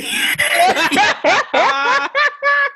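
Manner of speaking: long high burst of laughter. Real, not acted. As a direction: laugh out loud, high and free. Laughing at the story.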